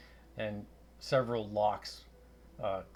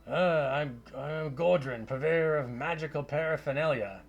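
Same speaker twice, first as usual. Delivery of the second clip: raised, loud Scottish wizard voice; Scottish accent; 6 semitones higher, 7 dB louder than usual. Acted. Portly wizard introduces himself to adventurers, Scottish accent. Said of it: "a Scottish accent".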